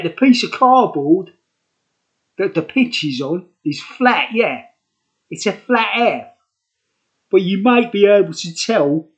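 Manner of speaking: mock London Cockney; phony Cockney accent. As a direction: ham up a Cockney geezer. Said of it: "That's me phony Cockney accents".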